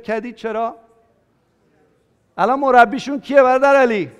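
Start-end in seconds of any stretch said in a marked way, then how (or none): 2.4-4.1 loud call across the room to Ali. Real, not acted. Preacher calling out to brother Ali in the congregation, loud. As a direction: raise the voice to reach a man seated far back.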